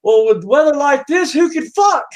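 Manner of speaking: high, loud indignant punchline; exasperated. Acted. Customer walking out on the storm act, exasperated. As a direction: throw it out as a fed-up rhetorical question.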